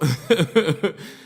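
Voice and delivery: a quick amused burst of laughter; short laugh. Real, not acted. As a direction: laugh it off in disbelief. Laughing at the coke offer.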